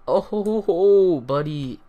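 loud, high whoop of glee; thrilled ho-ho cheer; gleeful. Real, not acted. Finds more diamonds.

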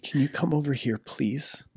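soft, overly polite pleading; mock polite plea. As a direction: sweetly ask him back from the cliff edge, mock-calm. Mock polite plea to daredevil son.

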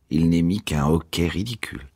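ordinary delivery

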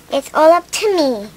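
ordinary delivery, nothing marked

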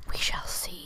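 whispered we shall see, no voice. Shown as a demonstration. To herself, planning the fort, a whisper. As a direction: breathe it out as a secret.

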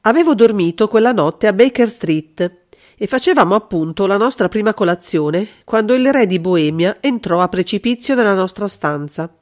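usual speaking voice

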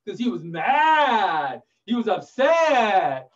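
slow, loud storyteller's outrage; hammed-up anger. A narrator's reading. Storyteller tells children the lion was angry, roaring it out. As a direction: stretch it out, roaring the last word.

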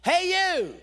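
loud, high-pitched hail to a stranger; shouted from a distance; calling out. Retold or imitated. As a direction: holler to get someone's attention. Stranger calls out across concourse.

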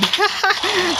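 sudden high-pitched burst of laughter. Real, not acted. Laughing at bots.

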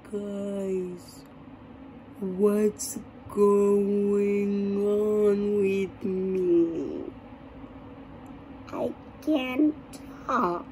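hushed, extremely drawn-out words, dragged low; talking in slow motion. Retold or imitated. Storyteller showing friends how stoned the speech came out, slow-motion talking. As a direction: act so stoned every word stretches out. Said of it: "I'm talking like this".

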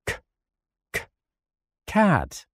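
the K sound spelled out, then cat; phonics drill. Shown as a demonstration. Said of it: "The answer is this".

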